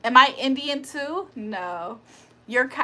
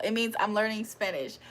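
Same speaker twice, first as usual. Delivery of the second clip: ordinary talk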